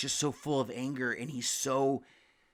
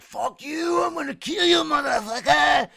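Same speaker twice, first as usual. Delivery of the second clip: much higher, louder mock shout from the shower; retold furious threat; 13 semitones higher, 8 dB louder than usual. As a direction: imitate the crying roommate still raging. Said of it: "but he's still like".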